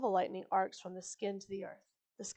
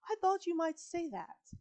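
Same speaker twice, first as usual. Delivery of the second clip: much higher, pleased-sounding reply through a smile; growing smile; 8 semitones higher than usual. A narrator's reading. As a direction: let the smile widen as you answer. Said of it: "her smile growing".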